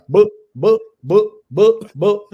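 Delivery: loud, high repeated "boop" noises; imitated speechless sputter. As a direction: pop out short boops, lost for words. Imitates the woman left speechless.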